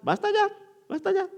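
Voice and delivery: "va a estallar" disparado muy agudo. Shown as a demonstration. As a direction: sube la voz al decir "estallar" como alarma. Advierte que la ira estalla. ("estallar" dicho muy agudo, en alarma)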